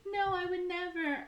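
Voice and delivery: very high mock-innocent heroine; hammed-up denial in a high voice; bad Ingrid Bergman. Accused woman denies it, bad Ingrid Bergman. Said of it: "with my bad Ingrid Bergman".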